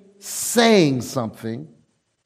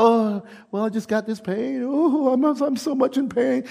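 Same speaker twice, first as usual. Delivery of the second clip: mock whining; 7 semitones higher than usual. Mocking complaining churchgoers.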